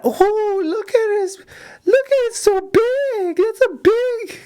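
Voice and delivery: imitating Filipino accent. Delivery-room nurse marvelling at the newborn, imitated in a high Filipino accent.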